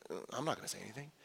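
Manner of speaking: fast, hushed mumble of refusal; hushed quick reply. Pretend. A disciple refusing to speak up, fast hushed mumble. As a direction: mutter it quickly, dodging. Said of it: "shooting looks at each other".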